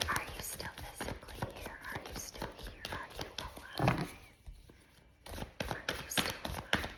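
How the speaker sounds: hushed whispered questions; whispering. Real, not acted. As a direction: whisper it secretly.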